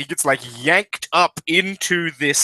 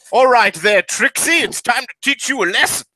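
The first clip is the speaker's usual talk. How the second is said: raised-pitch British challenge; big British guy; 6 semitones higher than usual. Acted. British mech pilot challenges Trixie, blustering British accent. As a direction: square up in a wartime British accent. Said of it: "it's a big British guy in a mech suit".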